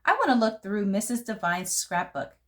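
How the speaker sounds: plain reading voice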